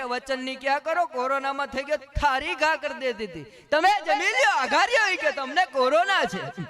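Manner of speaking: rising into a loud, high scolding yell; mock angry wife. A wife shouting at her husband to eat on his own, building into a mock yell.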